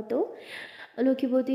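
plain reading voice

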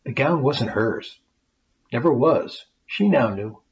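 lively rhyming storybook reading; story narration. A narrator's reading. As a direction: read it like a bedtime tale for kids. Reads the rhyming tale aloud.

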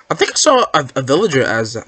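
ordinary delivery

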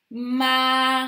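«Ma» prolongé jusqu'à un r appuyé; syllabe étirée. Shown as a demonstration. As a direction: tenir «Ma» puis faire racler le r final. Teacher sounds out mar. («Ma» fort et étiré pour déchiffrer)

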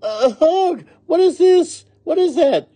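very high, loud cries of revulsion; disgusted shout. A narrator's reading. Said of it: "she shouted".

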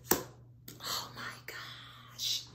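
very slow, soft whisper; hushed, awed whisper. Real, not acted. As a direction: breathe it out in awe. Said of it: "Why am I whispering?".

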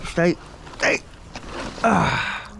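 ordinary talk, nothing marked

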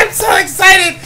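normal talking voice

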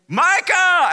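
high, loud call; shouting a name. Retold or imitated. Yelling to his friend lying silent. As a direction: yell his name in worry.

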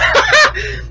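short, very high burst of laughter; real laughter. Real, not acted. Host after a campground warning, real laughter. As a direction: break into a quick high cackle. Laughs again at his joke.

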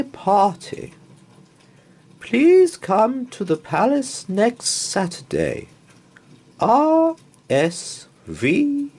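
high, loud, slow herald voice; grand formal invitation, pitched high and ringing; royal announcement. Acted. Queen's invitation read in grand voice.

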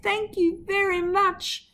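high, slow delighted thanks; a slow beaming thank you; child's happy call. A narrator's reading. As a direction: sing it out like a pleased little girl. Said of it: "as she called out".

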